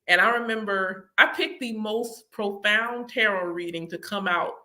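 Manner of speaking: ordinary delivery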